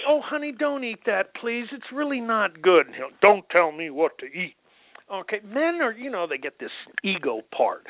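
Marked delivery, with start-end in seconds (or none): 0.1-2.9 higher-pitched, coaxing mock wife; pleading wife. Wife begging husband not to eat that, pleading imitation. 3.2-4.5 lightly voiced defensive husband; a touch of husbandly irritation; gruff husband retort. Husband rebuffing wife's advice on food, mildly gruff imitation. Husband snapping back at wife.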